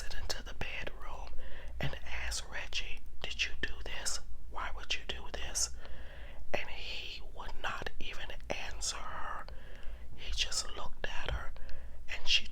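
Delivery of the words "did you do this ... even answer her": ordinary delivery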